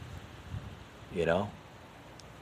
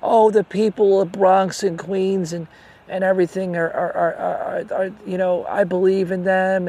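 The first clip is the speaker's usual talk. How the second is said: mocking imitation; 7 semitones higher than usual. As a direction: parrot her speeches with a mocking lilt. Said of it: "She's always talking like".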